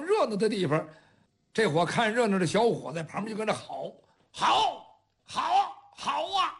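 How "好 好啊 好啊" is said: high, excited cheering crowd; 起哄叫好. Acted. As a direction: shout bravo like eager onlookers. 看热闹的小伙跟着吼好. (起哄叫好)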